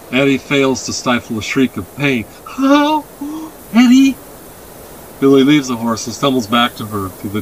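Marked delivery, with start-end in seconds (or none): 2.6-4.2 high, loud cry of alarm; alarmed boy. A narrator's reading. Boy hears his sister's pain, alarmed. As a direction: cry out for your hurt sister.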